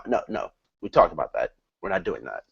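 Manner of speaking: ordinary talk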